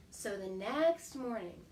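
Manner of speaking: usual speaking voice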